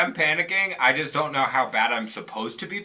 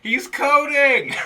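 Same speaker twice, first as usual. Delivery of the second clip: much higher, louder and slower; mock alarm cry; 12 semitones higher, 7 dB louder, slower than usual. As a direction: call it out like a panicked nurse. Joking about the alarms.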